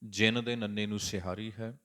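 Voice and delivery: ordinary talk, nothing marked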